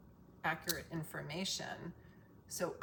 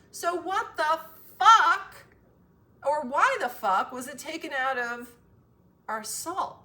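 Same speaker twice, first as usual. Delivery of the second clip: angry shout; 9 semitones higher, 12 dB louder than usual. Real, not acted. Outraged outburst.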